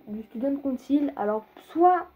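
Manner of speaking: normal talking voice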